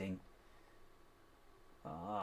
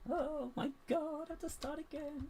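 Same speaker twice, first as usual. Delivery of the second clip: high strained voice. Real, not acted. Frustrated exclamation, high strained voice.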